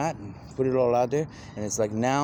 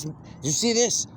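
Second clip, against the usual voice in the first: he can't contain the news and asks again, higher; more excited; 11 semitones higher than usual. Retold or imitated. Asks the preacher again, far higher and more excited. Repeating the question more insistently.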